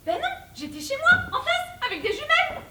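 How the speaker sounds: high, loud, emphatic outburst; high-pitched, insistent retort; loud indignant admission. Acted. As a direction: blurt it out loudly, pitch leaping up. Neighbour blurts out her spying.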